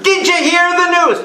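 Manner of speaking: put-on high loud old-woman call; loud old lady. An old woman at a wake shouting her news to the priest. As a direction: shout the gossip across the room. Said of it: "It was so loud".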